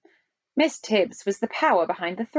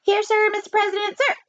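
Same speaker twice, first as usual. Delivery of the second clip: high falsetto chief spy; 12 semitones higher than usual. A narrator's reading. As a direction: answer eagerly in a high falsetto. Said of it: "and a falsetto voice".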